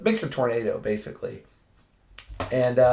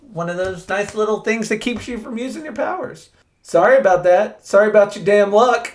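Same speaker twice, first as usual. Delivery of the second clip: high, loud, fast taunting sorry; Superman's high, loud mock apology; 8 semitones higher, 4 dB louder, faster than usual. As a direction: rub it in with a cheery sorry. Superman mocks Manchester.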